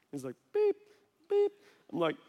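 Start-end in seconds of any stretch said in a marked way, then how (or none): 0.5-1.5 short, high-pitched beeps; imitated scanner beep. Cashier keeps scanning groceries, high imitated beeps. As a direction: mimic a checkout scanner twice.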